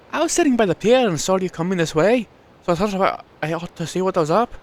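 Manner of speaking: lightly voiced Welsh village woman; Welsh accent. Frida greeting the stranger, lightly Welsh. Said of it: "I'm giving her a Welsh accent".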